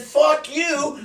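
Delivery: high-pitched retold curse; angry retort. Retold quarrel with the lineman.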